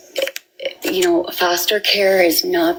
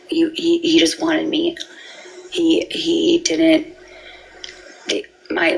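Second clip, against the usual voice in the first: usual speaking voice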